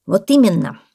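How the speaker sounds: loud, sudden exclamation; parrot exclaiming. A narrator's reading. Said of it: "воскликнул попугай". (восклицание попугая)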